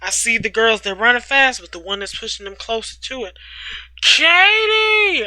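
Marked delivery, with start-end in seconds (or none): 4.0-5.3 calling out high and loud; shouting. Acted. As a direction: yell her name across the bay. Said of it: "I shout as loud as I can".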